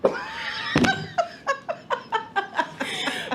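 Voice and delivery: high, breathless cackle trailing off; long real laughing fit; cracking up. Real, not acted. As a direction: laugh helplessly at the bit. Laughs at the stuttering bit.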